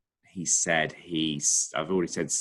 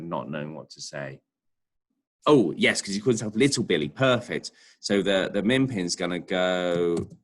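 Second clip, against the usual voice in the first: ordinary delivery, nothing marked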